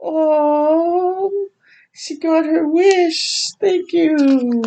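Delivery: high, broken voice; choked with real tears; overwhelmed reader crying. Real, not acted.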